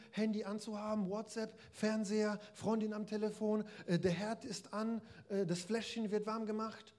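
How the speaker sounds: lifted, earnest Jesus voice talking to a busy sister; Jesus speaking. Acted. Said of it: "Jesus sagt, ja, du".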